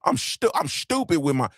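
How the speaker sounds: ordinary delivery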